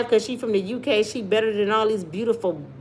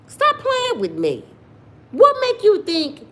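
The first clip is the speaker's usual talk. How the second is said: exasperated high; 12 semitones higher, 4 dB louder than usual. Real, not acted. Exasperated at brothers.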